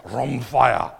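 "rhomphia" pushed out loudly and forcefully. Shown as a demonstration. Pastor contrasts it with machaira, "rhomphia" loud and forceful. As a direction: give "rhomphia" a big, powerful push. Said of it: "me trying to push it out".

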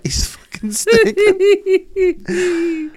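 high, slow, tearful laughing words; crying with laughter. Real, not acted. After reading Joyce aloud, he dissolves into laughter. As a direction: barely get it out through laughter.

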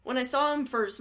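ordinary delivery

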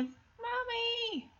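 put-on high, soft child's call for mother; lost little girl. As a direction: imitate a small child calling from far off. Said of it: "a lost little girl".

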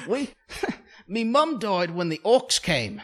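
hammed-up English accent; mock British accent. English newcomer recalling her fantasy homeland, mock British accent. Acting the English character, joking.

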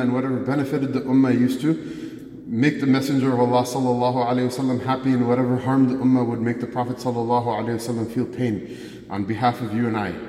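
normal talking voice

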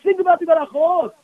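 ordinary delivery

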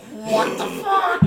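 high, loud, drawn-out mock outrage; man on breathing apparatus. Sal is told to get lost, hammed-up breathless disbelief. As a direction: exclaim slowly and high, as if through a breathing machine. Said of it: "with his breathing apparatus on".

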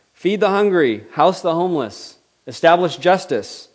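God's answer booming down. Acted. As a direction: proclaim it like a voice from heaven. Voice of God answering.

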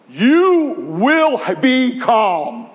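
slow, loud, drawn-out command; commanding rebuke. Retold or imitated. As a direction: order the sea to be still. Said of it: "Christ is saying".

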